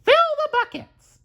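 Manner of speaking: high cartoon mouse voice giving an order; Mickey commanding. Mickey ordering the broom in his high mouse voice. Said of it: "said Mickey".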